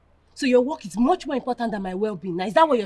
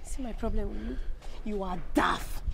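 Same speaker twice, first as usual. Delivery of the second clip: quiet scorn; 10 dB quieter than usual. Acted. Woman scorning her boyfriend's delight, quiet and contemptuous. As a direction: put him down quietly.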